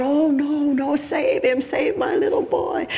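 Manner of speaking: high, pleading mock wail; desperate cry. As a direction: cry out as a frantic mother, hammed up. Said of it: "She cries out".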